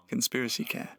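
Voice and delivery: usual speaking voice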